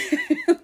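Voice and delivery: a quick high burst of laughter at herself; short bitter laugh. Real, not acted. She laughs at herself about her sister. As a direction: laugh at how blind you were.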